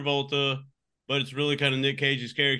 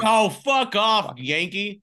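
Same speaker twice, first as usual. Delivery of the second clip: loud, drawn-out comeback; raised, slow banter put-down; mock-indignant retort; 4 semitones higher, 4 dB louder, much slower than usual. Real, not acted. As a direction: fire back loudly in jokey outrage. Teased back in banter argument.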